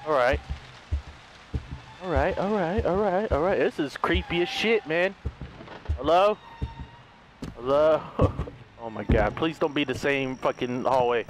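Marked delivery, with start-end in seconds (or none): none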